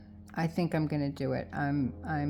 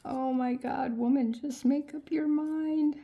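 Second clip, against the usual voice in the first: higher, a touch louder, mock scolding; mock exasperated viewer; 8 semitones higher, 4 dB louder than usual. Viewers scolding the indecisive crafter, mock exasperated. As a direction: play the fed-up viewer nagging her. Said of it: "you're probably screaming at me and be like".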